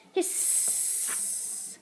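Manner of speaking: very soft, long voiceless hiss; sustained acted cat threat. A narrator's reading. Fearsome cat in aunt's arms, hissing.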